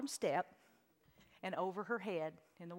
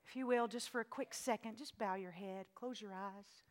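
closing prayer. Acted. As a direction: speak gently over the music.

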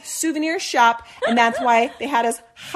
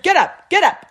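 loud, higher barked order; commanding shout; 7 semitones higher, 7 dB louder than usual. Retold or imitated. Policeman ordering the hiding girls up, shouting. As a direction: order them up off the floor.

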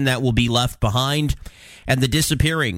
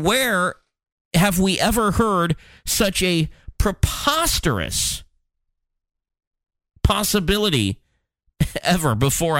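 higher-pitched mock disbelief with pointed pauses; sarcastic; 6 semitones higher than usual. Real, not acted. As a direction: feign incredulity, drawing out the absurdity. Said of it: "I'm being sarcastic".

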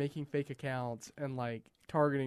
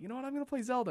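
much higher retold decision; playful sudden resolve; 9 semitones higher, much faster than usual. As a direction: pitch it way up, deciding on a whim. Retelling his next sudden gaming decision.

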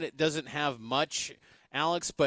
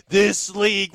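higher, louder, drawn-out league hype; NBA hype quote; 5 semitones higher, 9 dB louder, slower than usual. Retold or imitated. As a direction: say it like a hyped NBA tweet. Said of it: "what the people in the N B A say".

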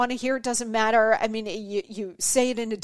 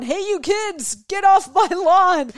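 high, hammed-up yell of a cranky old man; grumpy old man; 6 semitones higher, 6 dB louder than usual. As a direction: shout the kids off your lawn like a grouch. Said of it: "I'm sound like the old man outside on his lawn".

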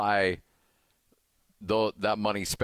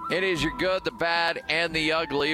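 higher, brighter segment call; hyped announcer echo; 8 semitones higher than usual. Acted. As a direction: pump up the title for listeners. Host echoes segment title.

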